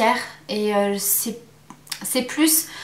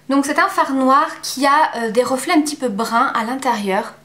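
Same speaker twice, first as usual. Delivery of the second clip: ordinary delivery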